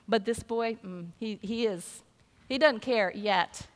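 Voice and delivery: usual speaking voice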